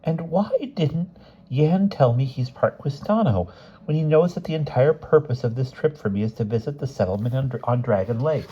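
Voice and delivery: high-pitched doctor character; inquisitive doctor. A narrator's reading.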